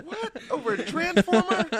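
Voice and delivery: high-pitched, laughing incredulity; mock disbelief. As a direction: squeak it out, appalled and amused. Parent's disbelief at kid, joking.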